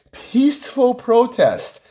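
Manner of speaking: slow, drawn-out sarcastic peaceful. Real, not acted. Sarcasm about peaceful protests, said slowly. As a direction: drag out peaceful with scorn.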